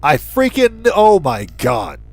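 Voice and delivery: ordinary talk, nothing marked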